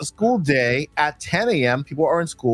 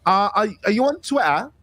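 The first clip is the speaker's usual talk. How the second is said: a put-on British accent, a little higher; mock British accent; 7 semitones higher than usual. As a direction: fake a posh British "Twitter" with a crisp T. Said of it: "I really wish I had a British accent".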